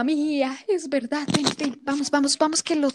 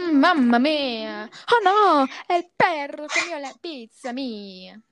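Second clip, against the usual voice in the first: exaggerated Italian exclamation; mock Italian accent; 2 semitones higher than usual. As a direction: cry out like a cartoon Italian. Playing Italian stereotype.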